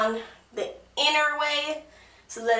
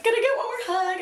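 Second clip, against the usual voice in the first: high excited voice; 9 semitones higher, much faster than usual. Retold or imitated. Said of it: "And I'm like".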